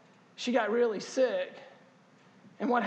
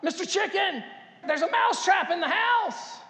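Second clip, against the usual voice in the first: high, loud, frantic squeal of a terrified mouse; a high, loud, panicked cartoon mouse voice; 9 semitones higher, 5 dB louder than usual. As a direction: beg for help in a high, shrill panic. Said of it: "He was like".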